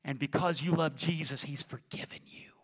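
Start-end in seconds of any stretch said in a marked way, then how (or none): 1.4-2.5 a hushed, tender promise; whispered reassurance. Real, not acted. Preacher whispering forgiveness to the congregation.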